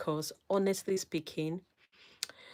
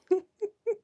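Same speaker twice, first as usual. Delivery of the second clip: giggle; 5 dB louder than usual. Real, not acted. Giggle at viewer.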